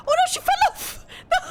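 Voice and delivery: high, loud, quick cry of dismay; real startled yelp tipping into laughter; amused alarm. Real, not acted. Owner sees the cat fall off, amused alarm. Pet falls off chair, real reaction.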